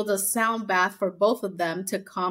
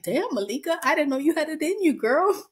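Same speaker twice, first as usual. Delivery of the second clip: impressed exclamation; 7 semitones higher than usual. Retold or imitated. Podcaster cheering Malika's jab, excited. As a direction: cheer her on, surprised. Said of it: "And I was like".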